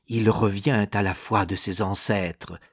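plain reading voice

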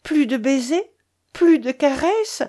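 mild, acted grief; narrated sobbing. A narrator's reading. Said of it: "ajouta-t-elle en sanglotant".

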